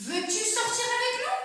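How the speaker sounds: high rise on "nous"; questioning tone. Shown as a demonstration. Teacher modelling an interrogative sentence with a high rising tune. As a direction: lift sharply at the end. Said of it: "il faut bien s'interroger".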